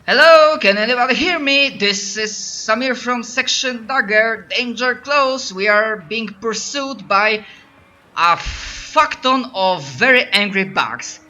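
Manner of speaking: high, loud, urgent distress call; Samir's radio call. Acted. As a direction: shout for backup over a crackling radio. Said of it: "what Samir is saying is, this".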